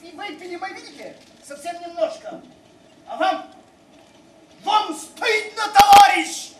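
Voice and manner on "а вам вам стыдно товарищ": indignant. Acted. Rebuking passenger indignantly.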